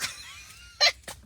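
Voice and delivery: real squeal of a laugh, very high. Real, not acted. Cracking up at a rap line, high squeal.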